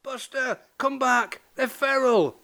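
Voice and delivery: high-pitched, held-back calling out; mock roar. A narrator's reading. Moggy warning runaway friend, mock roar. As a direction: call urgently, high but without volume. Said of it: "roared Moggy".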